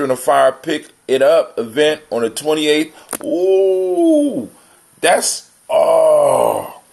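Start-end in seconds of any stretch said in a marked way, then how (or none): none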